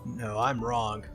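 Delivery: ordinary delivery, nothing marked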